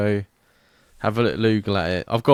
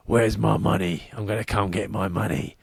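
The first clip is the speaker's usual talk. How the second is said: proper gangster. Retold or imitated. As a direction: drop your voice into a gangster threat. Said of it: "proper gangster".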